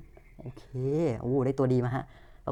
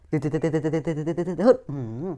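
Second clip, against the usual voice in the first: vocal beeping; 3 dB louder than usual. Pretend. Mouth sound while cards open.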